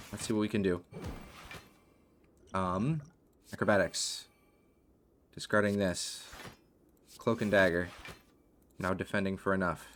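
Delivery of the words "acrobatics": ordinary talk, nothing marked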